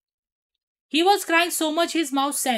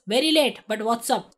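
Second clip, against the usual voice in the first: ordinary delivery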